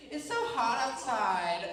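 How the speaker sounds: hammed-up high, drawn-out whine; complaining. Passenger griping on a family road trip, whiny complaining. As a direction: moan it slowly, never satisfied. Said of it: "it's a complaint about everything".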